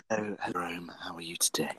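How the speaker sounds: low teasing whisper; weird flirty voice. Retold or imitated. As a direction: whisper it to wind him up. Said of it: "I always do that voice to Jerome".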